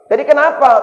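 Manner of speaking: mock impatient mother. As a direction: demand an answer like an exasperated mum. Mother interrogates child.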